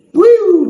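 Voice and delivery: apito agudo e alto como sirene; sirene de alerta. Retold or imitated. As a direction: soar como um alarme no peito. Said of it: "Sabe quando você sente assim". (o Espírito Santo apitando como sirene)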